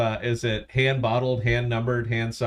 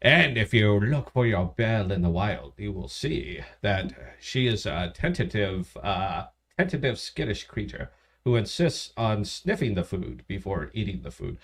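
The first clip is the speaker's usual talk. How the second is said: hushed narration; 5 semitones lower, 3 dB quieter than usual. Acted. Co-host narrating the taster in the wild, hushed nature narration. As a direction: narrate softly as if watching a skittish animal. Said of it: "I'll go ahead and narrate".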